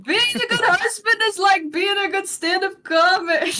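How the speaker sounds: high, slow sitcom stand-up voice; Jerry Seinfeld impression. Shown as a demonstration. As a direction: do the Seinfeld bit, drawn out. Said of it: "Jerry Seinfeld".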